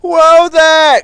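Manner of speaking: loud high cry. A narrator's reading. Ten Cents crying out as barge swings, loud. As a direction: cry out in alarm. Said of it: "cried Ten Cents".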